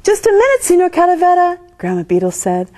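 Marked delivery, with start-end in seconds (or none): 0.0-1.6 high, bright call to stop him. A narrator's reading. Grandma calling Signor Calavera back, a high call.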